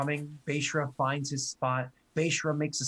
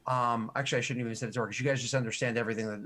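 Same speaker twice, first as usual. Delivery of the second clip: ordinary talk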